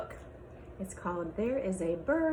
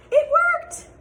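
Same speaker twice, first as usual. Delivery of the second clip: delighted gasp; 14 dB louder than usual. Acted. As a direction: gasp then very high, loud joyful cry. Birds agree to leave.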